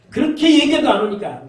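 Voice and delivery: high, loud exasperated complaint; the Lord, fed up. Acted. The Lord to sheep who will not come, angry. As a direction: burst out in frustration at being ignored. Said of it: "주님은 지금 그런 심정으로".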